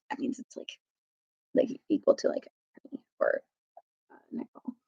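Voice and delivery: a soft, breathy whisper; whispered aside. Real, not acted. Reader explaining the coin to a child, in a whisper. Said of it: "whispered aside to listener".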